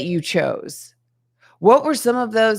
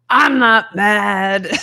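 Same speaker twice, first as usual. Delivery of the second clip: slow, drawn-out, louder denial through a laugh; sarcastic not mad; 5 dB louder, much slower than usual. Retold or imitated. Woman answering the guy who told her not to get mad, slow laughing sarcasm. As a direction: play the woman who's clearly mad, laughing it out slowly. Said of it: "And the woman's like".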